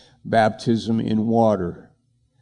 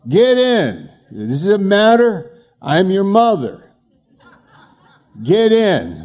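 raised and firm, not to be argued with; mock bossy mother; 6 semitones higher, 3 dB louder than usual. Mother ordering her son into the car, hammed-up firm. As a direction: boss your son into the car. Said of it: "she goes".

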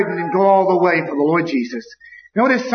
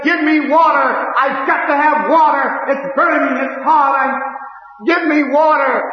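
anguished cry for water; 6 semitones higher than usual. Retold or imitated. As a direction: beg from the burning. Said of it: "And he said".

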